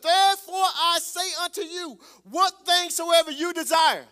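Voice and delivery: loud, rising preacher cadence, hammering each phrase; preaching at full force. Acted. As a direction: preach it to the back row.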